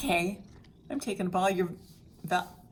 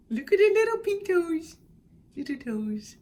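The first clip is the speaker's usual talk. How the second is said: much higher, louder cooing over tiny toes; 11 semitones higher, 7 dB louder than usual. Real, not acted.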